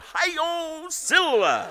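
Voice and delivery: high mock yell of a heckler; retold yell. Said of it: "a clown in the balcony yells out".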